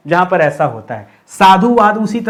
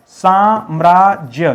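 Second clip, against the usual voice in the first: slow; much slower than usual. Shown as a demonstration. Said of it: "क्या होता है?".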